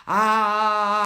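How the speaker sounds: loud, stretched-out A for face gym; exaggerated vowel A. Shown as a demonstration. Coach showing viewers the face-gym vowel, a loud drawn-out A. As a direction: open the mouth wide and hold a big A. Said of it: "on commence avec les A".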